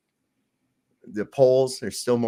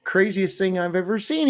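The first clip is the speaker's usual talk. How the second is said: higher pitched astonished barman; amazed bartender; 9 semitones higher than usual. Acted. Bartender marvels at the tiny piano, astonished. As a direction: marvel at it, voice up high. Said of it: "He says".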